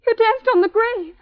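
high, mounting hysteria; hysterical horror. Acted. Edna accusing Kay of dancing on a grave, hysterical. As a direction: accuse in rising, horrified panic. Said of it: "Edna, stop acting like that".

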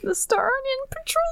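high, soft delighted squeal; cute-name squeal. Real, not acted. Cohost melting over the Star Onion Patrol name, delighted squeal. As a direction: coo it very high, tapering to airy softness.

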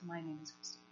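very soft, low and halting; near-whispered retelling of a tearful moment; hushed, moved. Retold or imitated. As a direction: let it drop to a tender hush. She starts weeping.

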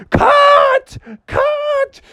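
squeaky frantic cries; very high panicked yells. Acted. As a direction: yelp in panic. Family panics.

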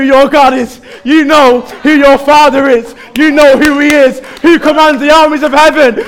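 loud, high, impassioned rallying cry; full-force preaching. Real, not acted. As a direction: rouse the church, building to a shout.

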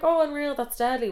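high-pitched, chirpy polite interest; feigned enthusiasm. Retold or imitated. Replying to date's work trip news, brightly enthusiastic. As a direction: gush over small talk.

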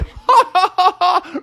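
high squeaky cackle; a shrill-pitched taunting laugh; mocking laugh. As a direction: skunk laughing at his rival. Stinky laughs at Bubby.

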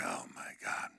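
hushed, whispered disbelief; very soft, low mock-horrified whisper; dismayed whisper. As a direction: whisper it under your breath in dismay. Child's dismayed aside in retelling.

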